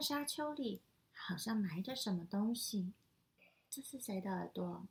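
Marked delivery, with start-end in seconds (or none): 3.7-4.9 soft unvoiced question; whispering. Real, not acted. Reader asks child softly. (悄声问)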